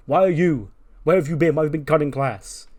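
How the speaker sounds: acted telling-off of students; scolding. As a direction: fire off accusing questions. Teacher scolds truant students.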